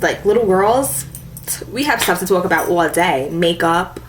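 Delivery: ordinary talk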